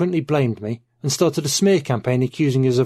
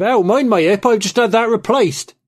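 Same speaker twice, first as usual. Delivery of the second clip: higher and louder, a yelp of pain; pained cry; 10 semitones higher, 6 dB louder than usual. Acted. Old man caught in a dinner brawl cries out, pained yelp. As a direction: cry out as someone knocks your new hip. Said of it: "fizzled out with cries of".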